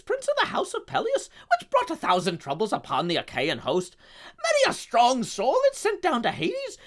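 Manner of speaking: loud, booming Scottish epic recitation; Scottish accent. Shown as a demonstration. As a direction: declaim Homer like a roaring Scottish chieftain. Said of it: "say with this accent to demonstrate".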